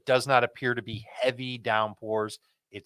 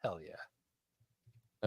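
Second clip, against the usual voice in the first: whispering; 6 semitones lower, 12 dB quieter than usual. Real, not acted. Quiet cheer after agreement.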